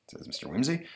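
plain reading voice

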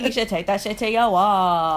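loud, high, hammed-up proclamation; belted slogan. Echoing a friend's slogan back playfully, belted loud. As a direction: belt it out like a playful anthem.

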